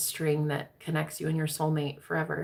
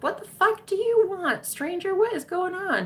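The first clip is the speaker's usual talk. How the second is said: high-pitched, incredulous squeal; mock outrage; 12 semitones higher than usual. Squealing back at an ex who texted out of nowhere. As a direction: shriek it like you can't believe he texted. She answers his surprise text.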